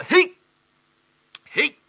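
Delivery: high, loud jolted answer; startled reply. Acted. Clerk jolted awake answers his master, high startled reply.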